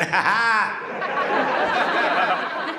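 cocky laugh; confident laugh. Retold or imitated. Boxer laughs to show his coach confidence. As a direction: laugh like you've got this. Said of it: "Put a confident laugh behind it".

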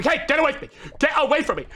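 high, urgent yelling; agitated shout. Real, not acted. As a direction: shout it to drive it away. Fends off pest.

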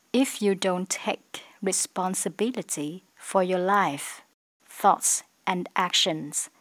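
slow, careful English read; slow model reading. Shown as a demonstration. Teacher rereads the sentence slowly to students. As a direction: chunk it clearly for learners. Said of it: "teacher rereads model sentence".

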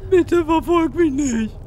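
loud, high, drawn-out plea; high whining plea; frightened pleading. Real, not acted. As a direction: wail it slowly, begging to be spared. Begs game monster not to follow.

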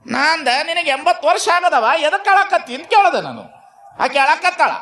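high, projected storyteller's voice; animated sermon storytelling. Real, not acted. Preacher tells of an old woman crying on the porch, animated high storytelling. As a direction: tell the tale pitched high for a big crowd.

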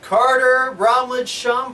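drawn-out winner announcement, high and loud; excited announcing. Real, not acted. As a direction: call the winner's name out slowly. Drawing a prize winner's name.

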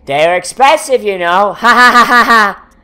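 mock Mister Smiley.

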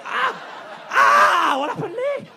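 high, loud, startled yelp; mock shocked yelp. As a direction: act out the painful surprise. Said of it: "you're like".

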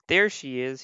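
mild excited storybook cry; a touch of eager discovery; little bird spotting mother, lightly acted. A narrator's reading. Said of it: "he cried".

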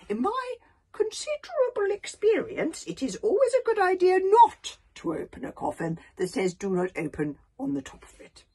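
put-on a frail old elder butting in. Old Wrinkly warns the tribe.

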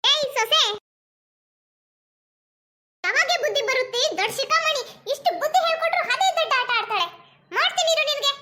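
put-on high, loud furious old mother-in-law; angry mother-in-law yell. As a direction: shout her down in a rage. Mother-in-law yells at daughter-in-law. (ಕೋಪದಿಂದ ಕೂಗುವ ಅತ್ತೆ)

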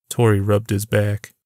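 ordinary delivery

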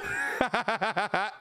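rapid ha-ha-ha laugh; real laugh. Real, not acted. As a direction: burst out cackling. Cracks up at chat.

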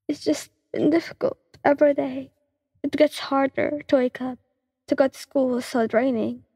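a touch of crying in every line; through tears. Acted. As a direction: break down apologizing, voice small. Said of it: "He explained through tears".

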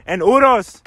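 loud energetic channel opener; hype YouTuber intro. Acted. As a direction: pump up the viewers with a big shout-out.

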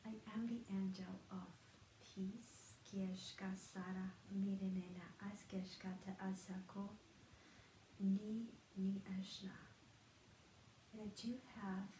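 soft, half-whispered meditative channeling; channeled light language. Acted. Healer channeling an angel of peace, hushed and half-whispered. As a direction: let the words come out hushed, like an incantation.

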